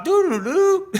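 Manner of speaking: high sung phone beeps; imitated disconnected tone. Calling the label and hitting the dead-line tone, high beeps. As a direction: mimic the disconnected-number tones.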